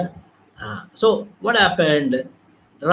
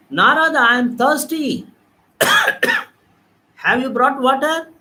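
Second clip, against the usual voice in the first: lightly voiced Krishna calling from afar; 5 semitones higher than usual. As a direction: voice of Krishna, a bit higher. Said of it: "Lord Krishna was asking".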